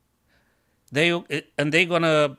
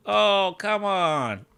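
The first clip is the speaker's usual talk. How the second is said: exasperated groan; 6 semitones higher, much slower than usual. Real, not acted. Rook lost.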